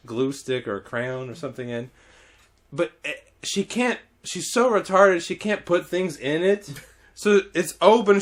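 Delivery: ordinary talk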